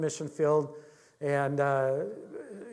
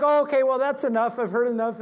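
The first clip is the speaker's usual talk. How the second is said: high, loud, hurried brush-off; flustered woman; 7 semitones higher, 6 dB louder than usual. Retold or imitated. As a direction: wave him off, high and flustered. Woman trying to escape.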